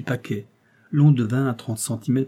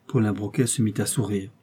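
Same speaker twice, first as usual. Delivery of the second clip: usual speaking voice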